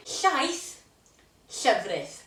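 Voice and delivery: slow Welsh drill; Welsh word pair. Shown as a demonstration. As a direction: contrast Llaeth and llefrith. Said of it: "You decide which one you wanna try".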